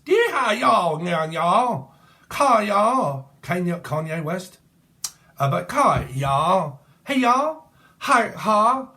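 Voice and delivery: high, loud mock Texan holler; Texan accent. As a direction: whoop it up like a caricature Texan. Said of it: "y'all do talk like that".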